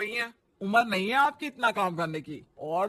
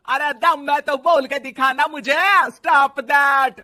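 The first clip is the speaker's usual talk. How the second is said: shouted dare; 5 semitones higher, 8 dB louder than usual. Acted. Mother-in-law daring shouting.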